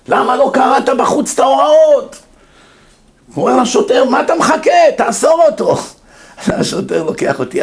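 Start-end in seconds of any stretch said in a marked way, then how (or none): 0.1-2.2 high mock shout; hammed-up scolding in a raised pitch; angry judge. Judge scolding man for not reading rules, acted anger. Judge yelling back at apology. 4.0-5.8 high, shouted mock order; impatient judge. Judge hurrying policeman to arrest him, acted shout.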